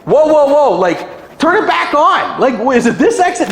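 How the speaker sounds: high, loud, panicky driver; a driver who just lost the GPS, panicking; hammed-up lost-driver panic; mock panicked driver. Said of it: "you're like".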